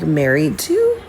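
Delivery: ordinary talk, nothing marked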